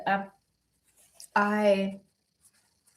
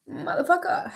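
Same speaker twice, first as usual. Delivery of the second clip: 1 tearful curse; 8 semitones higher than usual. Real, not acted. Swearing while crying.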